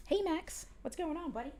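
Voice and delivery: high, soft cooing at the cat; pet talk. Real, not acted. Owner greets her meowing cat in high, soft pet talk. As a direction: coo to your buddy like a pet owner.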